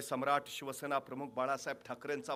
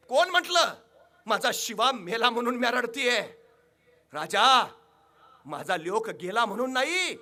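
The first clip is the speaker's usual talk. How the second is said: loud, much higher rustic mother, fierce and grieving; sharp retort; 7 semitones higher, 10 dB louder than usual. Acted. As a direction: fire back at the king with fierce pride. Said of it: "ताडकन Shiva काशीची आई म्हणाली". (ताडकन, मोठ्याने दिलेले आईचे उत्तर)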